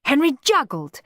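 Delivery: ordinary delivery, nothing marked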